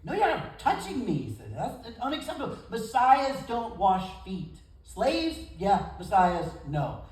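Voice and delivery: hammed-up refusal, as if scandalized; indignant Peter. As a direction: Peter refusing to let Jesus wash his feet, mock indignant. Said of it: "he refused to let Jesus wash his feet".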